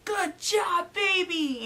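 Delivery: high, loud celebratory shout; jubilant cheer. Retold or imitated. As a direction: imitate an overjoyed husband cheering. Husband celebrating the twins news.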